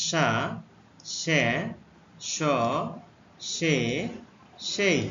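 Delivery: সা, সে, স, সে, সেই said one by one, slow and clear; teacher modelling সা সে স সে সেই; letter sounds demo. Shown as a demonstration. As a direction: model সা সে স সে সেই with a pause after each. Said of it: "পাঁচ ধরনের উচ্চারণ হতে পারে".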